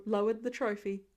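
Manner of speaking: plain reading voice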